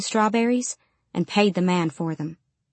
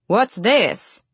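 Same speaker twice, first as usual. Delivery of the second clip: mildly booming big man's voice; 3 semitones higher than usual. A narrator's reading. Store manager confronting a little girl, booming. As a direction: boom it out a little, like a man in charge. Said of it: "he said in a booming voice".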